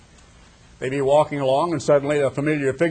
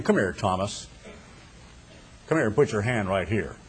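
soft and low, gently inviting Thomas closer; hushed Jesus voice; 5 semitones lower, 6 dB quieter than usual. Acted. Risen Jesus calls doubting Thomas over, hushed and gentle. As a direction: coax him over quietly, like a secret shown.

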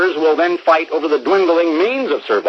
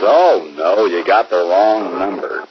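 groggy, slow, just woken by the phone; sleepy voice; 6 semitones lower, slower than usual. Retold or imitated. Stranger woken at night answering the phone, sleepy. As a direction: mumble it half asleep, slower at the end. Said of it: "Sleepy voice at the other end".

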